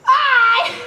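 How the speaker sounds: put-on loud, high child's yelp, tickled; mock toddler cry. Nurse mimicking the little child in her story, mock cry. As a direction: squeal it like a tot, half laughing. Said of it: "left crying and say".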